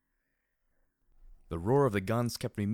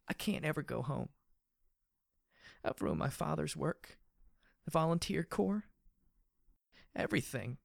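weeping; 8 semitones higher than usual. A narrator's reading. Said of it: "she wept with tiny little gasping sobs".

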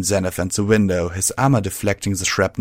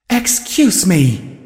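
much higher, slow, ringing and loud; magically amplified voice; 11 semitones higher, 6 dB louder, much slower than usual. A narrator's reading. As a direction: boom it out as if amplified. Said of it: "magically amplified voice rang out".

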